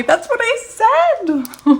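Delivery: very high, loud, drawn-out squeal of vindication; so excited. Real, not acted. Reader sees the book confirm her words, excited. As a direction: shriek it out slowly, thrilled. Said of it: "I'm so excited".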